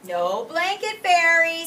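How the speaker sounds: high, loud and slow, triumphant; a boy proudly announcing; Owen. Acted. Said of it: "said Owen".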